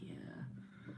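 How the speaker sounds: whispered yeah. Retold or imitated. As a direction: hush it like a whisper. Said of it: "She's like".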